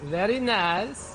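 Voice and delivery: high, slow, drawn-out mock-Kazakh accent; Borat. Friend repeating the catchphrase, drawn-out Borat accent. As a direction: stretch it out in a comic Borat accent, rising. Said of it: "I always say it in a Borat accent or a Borat voice".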